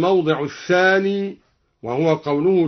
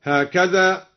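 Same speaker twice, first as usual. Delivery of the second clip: ordinary delivery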